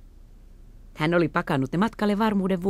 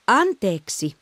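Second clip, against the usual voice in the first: "Anteeksi" firmer and louder, falling; louder second "Anteeksi" to the old man; louder apology; 5 semitones higher, 4 dB louder, much slower than usual. Acted. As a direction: repeat "Anteeksi" so he hears. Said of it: "tällä kertaa kovemmalla äänellä".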